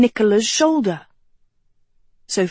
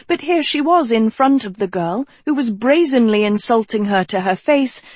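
plain reading voice

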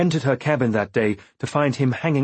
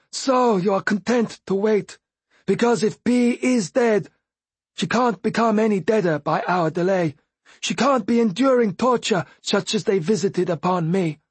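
higher, wounded voice for the Fool; bitter Fool voice; 10 semitones higher than usual. A narrator's reading. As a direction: voice the Fool, resentful. Fool's harsh dialogue.

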